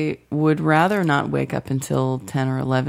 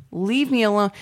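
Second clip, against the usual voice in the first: higher, put-upon complaint; mock exasperated plea; 6 semitones higher than usual. Sleeper to mother at the door, mock exasperation. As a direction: groan it like a pestered sleeper, mildly hammed up. Acted reply to mother waking her.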